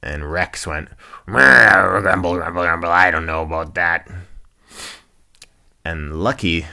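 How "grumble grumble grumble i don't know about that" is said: put-on loud, grumbling old rhino; Rex. Grumpy rhino doubting happy hippo, loud grumbling voice. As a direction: rumble out your doubt, louder at the start. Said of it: "grumpy old cantankerous rhinoceros".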